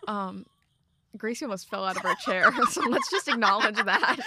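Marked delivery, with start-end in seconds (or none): 2.2-4.3 giggling through the words; laughing. Real, not acted. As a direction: laugh while you talk. Gracie almost fell off chair.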